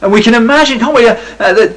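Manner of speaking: ordinary delivery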